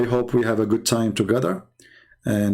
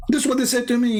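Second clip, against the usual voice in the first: ordinary delivery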